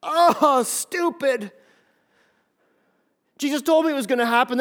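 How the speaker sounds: loud, high-pitched exasperation; self-reproach. Acted. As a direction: berate yourself as the regretful disciple. Acting Peter berating himself.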